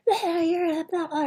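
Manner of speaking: high, loud imitated raging "rah"; mock rage roar. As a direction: roar like a drunk raging dad. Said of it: "then he'll be like".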